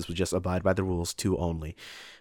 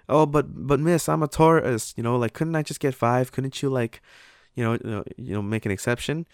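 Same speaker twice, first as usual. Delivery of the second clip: higher, pleading mock voice; imitating the tourist; 6 semitones higher than usual. Tourist begging the assistant manager for an exception, mock pleading. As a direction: whine it out like a pushy tourist.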